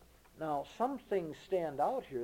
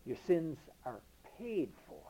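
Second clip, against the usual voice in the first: ordinary delivery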